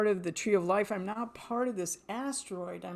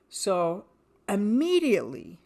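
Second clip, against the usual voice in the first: ordinary talk, nothing marked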